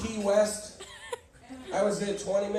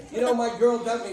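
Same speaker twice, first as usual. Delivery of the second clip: ordinary delivery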